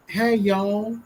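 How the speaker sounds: Southern "y'all" held long in mockery; mock Southern accent. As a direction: imitate a put-on Southern drawl. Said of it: "have the fucking Southern accent".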